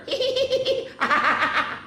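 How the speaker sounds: long high peal of giggles; giggling fit. A narrator's reading. Bismarck losing the underwear dare, giggling fit. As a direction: giggle on and on helplessly. Buffalo laughing hard.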